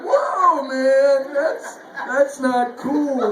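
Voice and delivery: high, loud startled whoa, then a slow drawn-out not cool; shocked whoa. Retold or imitated. Customer recoiling at the wood chipper joke, shocked and loud.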